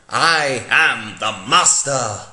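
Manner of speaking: menacing Master. Acted. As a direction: drag out the villain's menace. Decayed Master hologram speaks.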